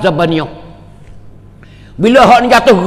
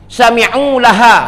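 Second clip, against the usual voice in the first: drawn-out chanted hadith; slow Arabic recitation; 4 semitones higher, slower than usual. Acted. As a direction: intone the Arabic slowly and reverently. Reciting Quran verse.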